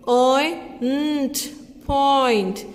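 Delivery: slow oy n t then point; sounding out. Shown as a demonstration. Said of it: "This means". (ध्वनियाँ जोड़कर पॉइंट)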